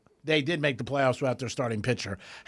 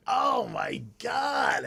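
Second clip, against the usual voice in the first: drawn-out exasperated shout; 8 semitones higher, much slower than usual. Real, not acted. Outraged at the salary.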